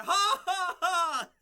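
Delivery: loud, high mocking villain cackle; villain's laugh. Returning hero mocks his fallen foes with a cackle. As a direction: throw out a big triumphant evil laugh. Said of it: "you hear that laughter".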